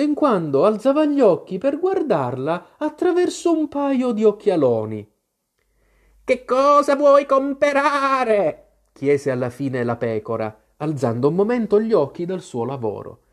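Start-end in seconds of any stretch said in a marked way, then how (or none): 6.2-8.7 much higher, slow bleating sheep voice; old sheep shopkeeper. A narrator's reading. Old sheep shopkeeper asks Alice, slow bleating voice. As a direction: ask like an old knitting ewe behind the counter.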